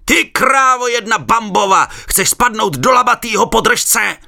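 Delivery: slow loud high threat; angry yelling. A narrator's reading. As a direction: bellow the threat from the river. Firemen threatening from the river.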